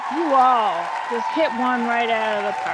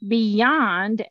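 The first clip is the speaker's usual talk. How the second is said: ordinary talk, nothing marked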